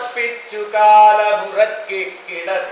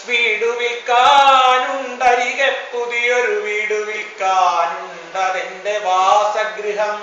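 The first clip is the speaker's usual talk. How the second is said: raised, ringing verse recitation; proclaimed refrain. Acted. Poet announcing the new house for sale, proclaiming. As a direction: proclaim it, lifting the first half and settling at the end.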